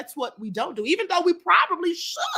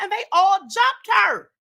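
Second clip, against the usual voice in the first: indignant shout; 6 semitones higher, 3 dB louder, slower than usual. Real, not acted. Indignant retelling of a jumping.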